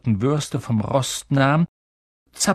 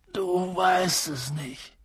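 soft, slow disbelief, pitched up; stunned; 5 semitones higher, 6 dB quieter, slower than usual. A narrator's reading. Giant realizes the boy knows nothing, stunned and soft. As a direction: say it slowly under your breath.

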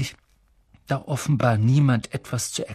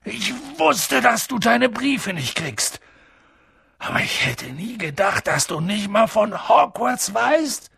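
high-pitched, indignant giant's outburst; upset giant; 7 semitones higher than usual. A narrator's reading. Giant laments that the boy knows nothing of Hogwarts, upset. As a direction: lament it with rising pitch and dismay.

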